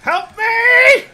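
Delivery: high panicked screams for help; screaming in fear. Real, not acted. Character grabbed in game.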